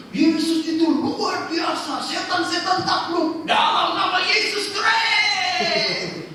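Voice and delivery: high, loud, slow mock shouting; mock hype shout. Preacher mocking showy believers, hammed-up slow shout. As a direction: ham up empty triumphant slogans.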